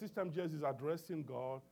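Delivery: ordinary talk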